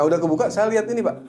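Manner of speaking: usual speaking voice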